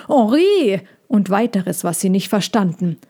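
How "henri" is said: a high, loud shout across the yard; shouting for someone far off; calling out. A narrator's reading. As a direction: call the name out. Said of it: "hörten sie ihn gleich darauf rufen".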